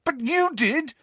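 high-pitched angry retort. A narrator's reading. Harry argues with Nick about returning, angry. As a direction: throw it back at him, pitched high. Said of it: "said Harry angrily".

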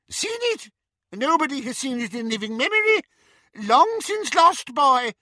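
very high squeaky little voice; squeaky Flitwick. A narrator's reading. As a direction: squeak it like a tiny old wizard. Flitwick voice held.

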